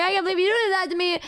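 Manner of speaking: high, quick retold whine; mock wounded complaint. Retelling a hotel tiff, mock hurt and whiny. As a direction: whine it in hammed-up betrayal.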